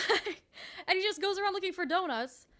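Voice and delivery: normal talking voice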